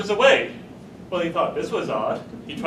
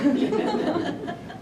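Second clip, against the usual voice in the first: laughter; 8 semitones higher than usual. Real, not acted. Teller laughs at own joke.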